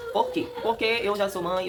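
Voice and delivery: ordinary talk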